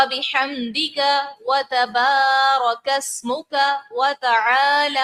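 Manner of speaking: chanted, very slow recital of سبحانک اللہم with drawn vowels; slow Quran recitation. Shown as a demonstration. Teacher demonstrating the correct recitation of the sana, slow and chanted. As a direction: recite the sana slowly and melodically. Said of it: "یہ بالکل درست طریقہ ہے پڑھنے کا".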